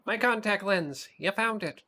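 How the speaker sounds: high excited monster voice; excited cartoon monster voice. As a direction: squeal it like a one-eyed monster. Reads Mike's lines in voice.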